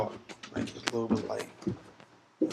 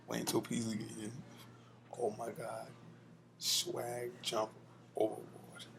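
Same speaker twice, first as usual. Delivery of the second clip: ordinary talk, nothing marked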